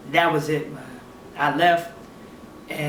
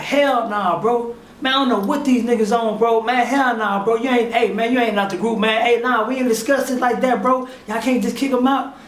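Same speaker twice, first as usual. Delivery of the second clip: higher, heated retold protest; outraged objection; 5 semitones higher, 3 dB louder than usual. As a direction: argue hard on a friend's behalf. Partner objects to the kick-out.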